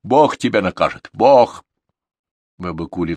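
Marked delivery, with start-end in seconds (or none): 0.0-1.7 threatening cry. A narrator's reading. Crone threatens God's punishment.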